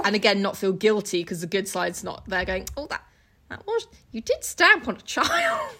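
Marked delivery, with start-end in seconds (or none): 2.8-5.7 high, shocked squeak; mock scandalised conscience. The good side scolding Jekyll, shocked high squeak.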